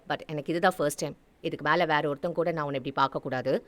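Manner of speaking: low, soft, quick male character voice; Kishore, young lover. Acted. Kishore confiding in his new lover, low and quick. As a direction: drop low and speak fast, privately.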